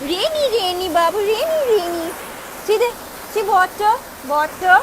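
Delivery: high coaxing pet talk, eager. Real, not acted. Owner calling her dog Champy out into the rain, high baby-talk. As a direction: sing it up high to lure the dog.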